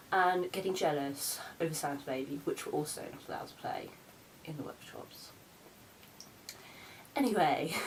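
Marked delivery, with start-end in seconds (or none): 2.4-5.3 whispered aside. Real, not acted. Aside muttered secretly.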